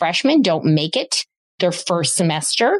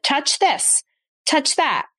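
higher, encouraging "touch this, touch that"; upbeat permissions; 8 semitones higher, slower than usual. Retold or imitated. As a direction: invite the child, pitch lifted and bright. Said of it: "she said".

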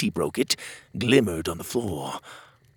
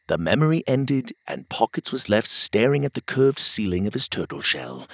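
usual speaking voice

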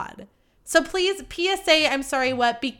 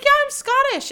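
high, loud imitation of a proud announcement; mock chirpy quote; 5 dB louder than usual. Podcaster imitating the wine pourer, mock chirpy. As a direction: imitate her, pitched way up.

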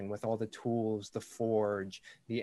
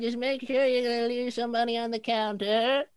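put-on very old woman; 12 semitones higher, 5 dB louder than usual. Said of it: "And she's like".

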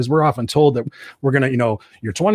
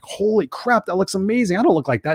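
amazed; 8 semitones higher than usual. Retold or imitated. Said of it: "I'm like".

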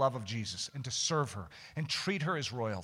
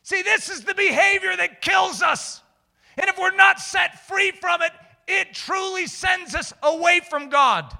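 high, loud, driving sermon voice; impassioned preaching; 10 dB louder than usual. Real, not acted. Preacher warning the congregation about sin, impassioned. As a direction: press the warning home with force.

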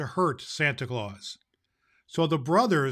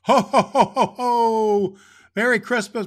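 big booming higher ho ho ho; jolly Santa laugh; 7 semitones higher, 10 dB louder than usual. Acted.

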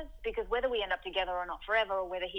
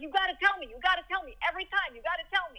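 a rough, higher imitation of a working-class Brooklyn guy; Brooklyn accent; 9 semitones higher, 4 dB louder than usual. Ex-boyfriend's blunt reply, in a butchered Brooklyn accent. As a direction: do his Brooklyn accent, badly and on purpose. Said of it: "I'm butchering his accent".

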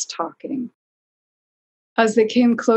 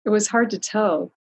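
ordinary delivery, nothing marked

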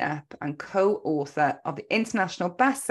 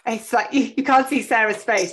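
ordinary talk

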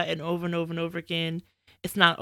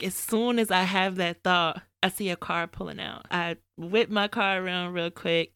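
usual speaking voice

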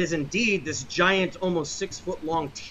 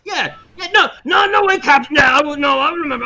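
loud, high rowdy shouting; crew shouting; 9 semitones higher, 11 dB louder than usual. Acted. Sailors shout at the captain all at once, loud and high. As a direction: shout over each other like a rowdy crew.

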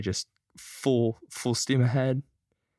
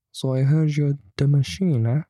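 mock tough-guy invitation to drink; mob guy taunt; 4 semitones higher, 5 dB louder than usual. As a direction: lean in and taunt him like a sneering mobster. Mob guy confronting him on train.

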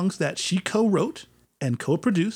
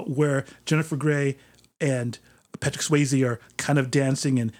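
ordinary delivery

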